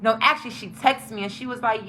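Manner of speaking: ordinary talk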